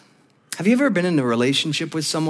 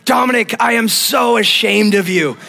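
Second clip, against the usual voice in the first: higher, scolding mom impression; mock ashamed mother; 5 semitones higher, 4 dB louder than usual. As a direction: scold your son down the phone, hammed-up. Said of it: "Voicemail number one".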